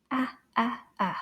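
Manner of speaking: short, separate "A" vowel repeated; isolated A sound. Shown as a demonstration. Teacher showing children the vowel sound, short and separated. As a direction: sound out "A" as in plage, in separate bursts. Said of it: "That is the A sound".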